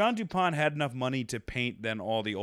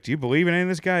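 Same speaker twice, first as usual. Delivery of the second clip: questioning character voice; 7 semitones higher, 5 dB louder, much faster than usual. Retold or imitated. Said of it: "he's like".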